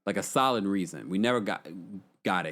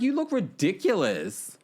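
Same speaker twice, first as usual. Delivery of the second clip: laughing; 10 semitones higher, much slower than usual. Retold or imitated. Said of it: "I'm like".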